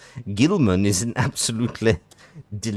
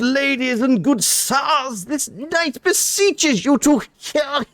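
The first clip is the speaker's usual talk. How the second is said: high, slow, pompous posh knight; a foppish knight begging with exaggerated courtesy; Punchably Posh; 3 dB louder than usual. Knight pleads with the worm-haters, punchably posh. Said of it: "Punchably Posh".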